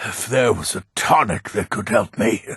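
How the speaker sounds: ordinary talk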